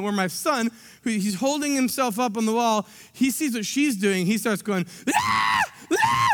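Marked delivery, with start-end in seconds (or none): 5.1-6.3 put-on high squealing baby shout; baby scream. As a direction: squeal like a delighted toddler. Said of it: "he's screaming".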